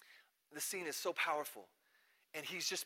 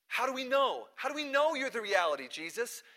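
higher, louder demanding questions; challenging; 8 semitones higher, 6 dB louder than usual. Retold or imitated. Doubters confront Jesus over his claim, challenging. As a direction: press Jesus hard for an answer.

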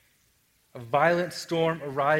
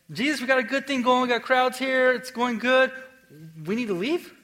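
higher, excited plea to stay put; eager disciples; 7 semitones higher than usual. Retold or imitated. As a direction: pitch it up like giddy disciples. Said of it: "the disciples are kinda, like, saying".

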